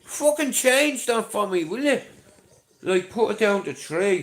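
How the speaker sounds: hammed-up telling off over the price; mock outraged order. To his missus about the coffee price, a hammed-up loud rant. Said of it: "I said to me missus".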